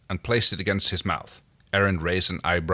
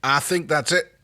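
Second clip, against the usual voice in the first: raised-pitch call, mildly projected; 7 semitones higher than usual. A narrator's reading. John tells Aaron the repair is finished, calling out. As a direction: call across to someone, lightly. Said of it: "he called out".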